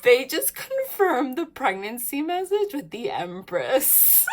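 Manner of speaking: high, loud, thrilled; laughing through excited words; giddy with excitement. Real, not acted. Excited over confirmation.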